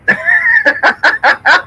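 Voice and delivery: high-pitched burst of laughter; laughing. Real, not acted. Joking about own mixed accent.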